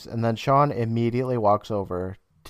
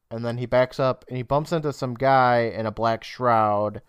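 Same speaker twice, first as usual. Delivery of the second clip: usual speaking voice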